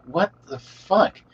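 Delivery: drawn-out, high incredulous what the fuck; retold shock, stretched slowly; stunned disbelief. Retelling his shock at coworker.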